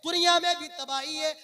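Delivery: loud, declaiming sermon with drawn-out phrase endings; full-force preaching. Acted. Preacher warning his brothers of punishment in hell, preaching at full force. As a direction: thunder out a grave warning to the congregation.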